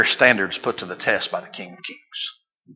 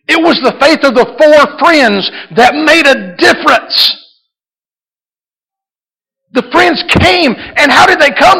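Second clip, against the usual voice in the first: high, loud, fervent preaching; full-force preaching; 11 dB louder than usual. Acted. Pastor urging the church to expect a miracle, fervent preaching.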